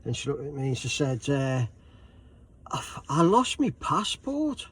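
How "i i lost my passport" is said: retold distress, high and slow; mildly distressed. Old lady confessing a mishap to a fellow passenger, distressed. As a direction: imitate a flustered old lady, pitched up and louder. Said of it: "a little bit distressed".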